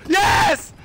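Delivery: high, loud shouts turning triumphant; real exultant outburst; shocked excitement. Real, not acted. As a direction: yell in disbelief, then celebrate.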